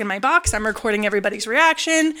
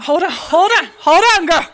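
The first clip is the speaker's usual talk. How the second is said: higher, louder, laughing as she cuts in; laughing hold on; 6 semitones higher, 5 dB louder than usual. Real, not acted. Teller laughing, stopping her friend's questions, loud and high.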